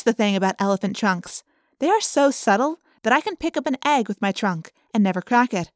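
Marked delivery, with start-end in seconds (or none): none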